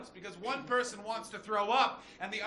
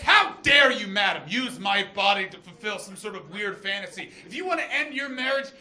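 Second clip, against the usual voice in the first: mock outrage; 3 semitones higher, 8 dB louder than usual. Wronged lover scolding a married woman, hammed-up indignation. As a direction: rebuke grandly, hammering it out loudly.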